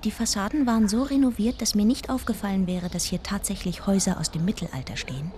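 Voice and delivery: hushed, even storytelling voice; narrating a trip remembered; story narration. A narrator's reading. First-person story narration.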